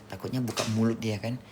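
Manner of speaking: ordinary talk, nothing marked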